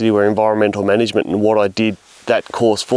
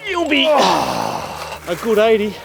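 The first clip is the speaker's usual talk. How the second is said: drawn-out excited; 11 semitones higher, much slower than usual. Real, not acted. Admiring the big fish.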